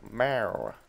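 playful put-on meow; cat imitation. Gamer playing about mid-game, imitated cat meow.

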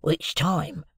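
put-on hushed old convict voice; a rough convict, keeping it hushed; Magwitch. A narrator's reading. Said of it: "with a sharp look".

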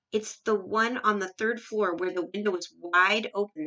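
plain reading voice

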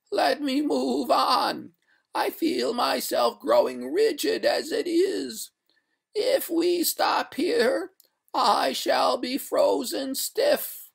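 very high, slow pleading; wailing ghost. A narrator's reading. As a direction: beg in a drawn-out ghostly wail. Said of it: "cried the ghost".

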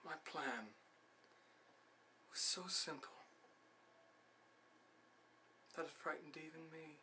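very soft, halting confidence; secretive confession. Acted. Salieri confessing his plan for death, hushed. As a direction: confide the plot under your breath.

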